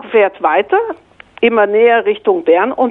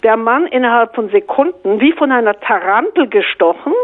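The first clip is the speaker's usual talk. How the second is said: normal talking voice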